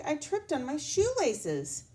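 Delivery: put-on high apologetic child voice; sorry kid. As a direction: say sorry like a small boy. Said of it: "said Zach".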